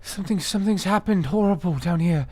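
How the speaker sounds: feigned distress. Acted. Player lures guards, mock frightened pleading. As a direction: play the panicked girl.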